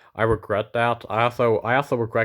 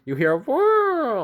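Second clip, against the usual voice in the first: mock door whir; 14 semitones higher, slower than usual. Imitating cat opening a door.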